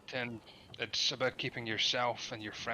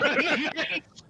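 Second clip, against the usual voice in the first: silly high voice; 9 dB louder, slower than usual. Pretend. Repeated line, slow and high.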